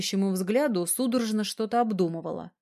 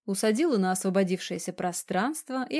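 usual speaking voice

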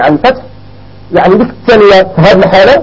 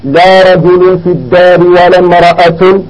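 slow model sentence; slower than usual. Shown as a demonstration. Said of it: "فنقول هكذا".

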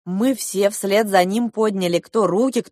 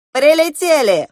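loud, high call with falling end; exclaimed announcement; 9 semitones higher, 7 dB louder than usual. A narrator's reading. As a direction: announce it firmly and brightly, high then dropping. Goose announces the landing.

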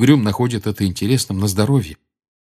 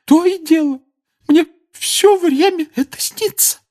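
very high, loud tearful crying; bitter crying complaint; 4 dB louder than usual. Acted. Mrs Weasley crying on Lupin's shoulder, bitterly sobbing. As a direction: sob out the words bitterly. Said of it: "горько жаловалась миссис Уизли".

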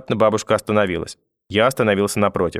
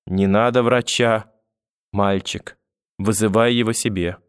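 slow, halting, weary words; faint, failing; slower than usual. A narrator's reading. Grandmother lying back refusing doctor, faint and laboured. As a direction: leave gaps as if strength is going. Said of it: "и захрипела".

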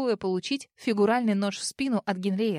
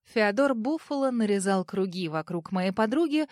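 ordinary delivery, nothing marked

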